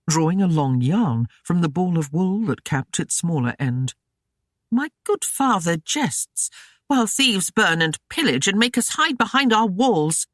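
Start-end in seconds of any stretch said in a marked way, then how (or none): 4.7-10.3 high, bitter snarl of a scold. A narrator's reading. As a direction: snarl it back at the old man. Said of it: "Gwyneth snarled".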